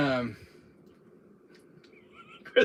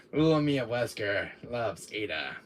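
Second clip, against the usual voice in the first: normal talking voice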